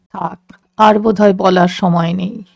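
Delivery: low-pitched voice of the remembered man; low voice out of her memory; man from the past. A narrator's reading. Remembered man answers her question.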